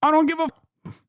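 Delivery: very high, cut-off outburst; exasperated dismissal. Real, not acted. Streamer dismissing the Israeli guest's take, high exasperated outburst. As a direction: throw it away in a high squeal.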